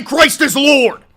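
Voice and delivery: slow, high, very loud preacher yell; hammed-up Bible-thumper scream; mock zealot bellow. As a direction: roar it like a furious street preacher. Mocking religious commenters.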